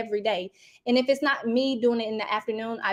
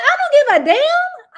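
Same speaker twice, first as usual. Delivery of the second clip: loud, high, laughing outburst; 9 dB louder than usual. Retold or imitated. Mom yelling at the school admin about the principal, loud laughing outburst. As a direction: yell it out, half laughing.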